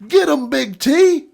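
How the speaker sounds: high, loud, slow cry through a laugh; laughing drunk plea. Retold or imitated. Knocked-down friend calling to dad, high laughing plea. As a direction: egg on your big buddy from the floor. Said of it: "he'd look at my dad and go".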